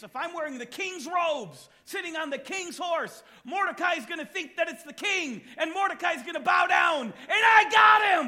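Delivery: scheming villain rising to a loud, triumphant shout; gloating Haman. Acted. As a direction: climb to a gleeful crowing peak on got. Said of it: "here's what he thinks".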